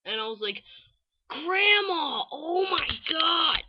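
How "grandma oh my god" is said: high, drawn-out, louder wail; hammed-up exasperation, pitched way up; mock mortified cry. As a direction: exclaim in acted embarrassment at your grandma. Retold embarrassed cry at grandma.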